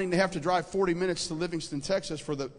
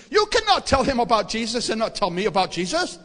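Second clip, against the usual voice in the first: put-on loud Indian-accented demand; insistent Indian shop owner; 4 semitones higher, 8 dB louder than usual. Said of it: "this little guy from India who owns the store".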